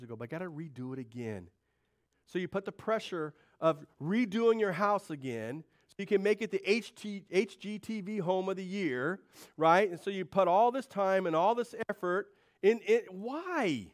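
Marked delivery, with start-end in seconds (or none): 2.3-13.9 louder, higher full-force preaching. Real, not acted. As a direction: drive the point home to the congregation.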